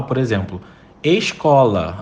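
normal talking voice

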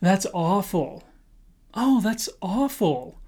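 slow, soft dismayed reaction; quiet slow pained reaction. Shown as a demonstration. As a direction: sigh it out with sympathy. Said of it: "You can also say".

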